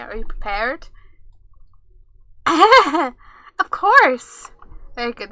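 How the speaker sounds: loud mock utility alert; hammed-up company alert; mock announcement. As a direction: read it like an automated notice. Reading mock safety alert.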